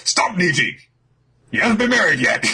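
high, loud frantic shout; father stopping his son's kick, loud frantic shout; mock panicked yell. Father Judge yells at son.

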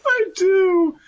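very high falsetto squeal; overeager vow. Acted. Answering the wedding vow, squealed overeagerness. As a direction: blurt it in hammed-up delight.

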